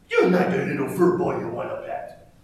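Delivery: a sneaky predator fox, voiced with a laugh in it; laughing fox taunt; practiced sly fox voice. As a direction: play the cunning fox teasing the listener. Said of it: "Yes, I practice that voice".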